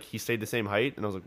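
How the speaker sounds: ordinary delivery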